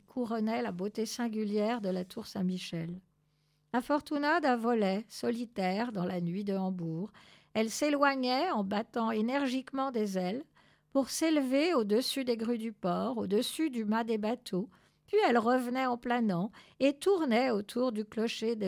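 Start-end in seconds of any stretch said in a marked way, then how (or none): none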